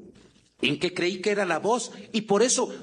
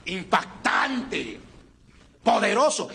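slow, put-on impressive radio voice; voz de radio impactante; much slower than usual. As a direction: draw it out to sound striking on air. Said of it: "quería que en la radio se escuchara a alguien". (voz impactante de radio, lenta)

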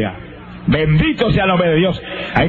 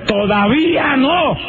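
slow, booming, higher divine command; thundering voice of God; 8 semitones higher, much slower than usual. Retold or imitated. God shouts at the sick man in his room, a slow thunderous command. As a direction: let God's voice roll out like thunder, slowly. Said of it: "con voz de trueno".

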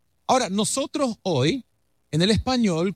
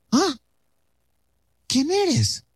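higher, awed question as Moses; Moses asking, surprised; 8 semitones higher, much slower than usual. Acted. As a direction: play Moses, wondering. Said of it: "Y Moisés le dice". (Moisés sorprendido, con voz más aguda)

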